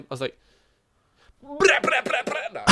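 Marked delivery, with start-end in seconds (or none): 1.4-2.4 high, loud rapid-fire babble; silly celebration noise. Retold or imitated. Gamer mimicking his reply to squad leader, high goofy noise.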